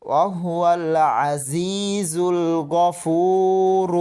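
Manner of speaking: slow melodic tilawat, long held final vowel; Quranic recitation. Shown as a demonstration. Teacher reciting the phrase for students, slow tilawat. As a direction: chant it slowly, stretching Gafur.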